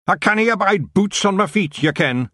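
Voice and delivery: high rough Scottish pixie; broad Scottish wee man; Scottish accent. Acted. Feagle speaks in Scots.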